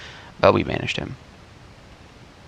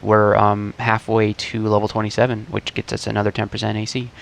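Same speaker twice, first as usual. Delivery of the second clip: ordinary talk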